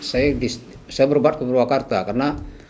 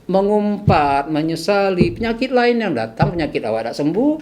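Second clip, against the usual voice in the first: higher, insistent lecturing voice; retold ranting; 7 semitones higher than usual. Patient lecturing an angry fellow patient, ranting mildly. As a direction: drive the point home, pitched up. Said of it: "Sampai saya ngoceh-ngoceh gitu".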